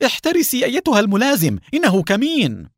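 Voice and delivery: fast, high, urgent cry of warning; warning shout. A narrator's reading. Qadri to the lieutenant walking into an ambush, urgent warning. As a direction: shout to save her, voice leaping up. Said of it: "وصاح محذرا".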